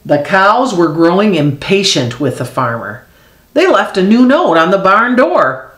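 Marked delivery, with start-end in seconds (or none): none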